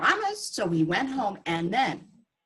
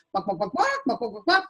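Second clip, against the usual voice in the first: chicken clucking. Acted. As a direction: play the chicken for the kids. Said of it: "the chicken".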